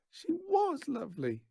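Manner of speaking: very high, wistful yell. A narrator's reading. Daughter yelling out about her mother, high voice. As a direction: shout it from the doorway. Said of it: "yells Natasha".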